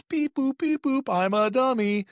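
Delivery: high beeping robot voice. Retold or imitated. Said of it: "some robot that goes".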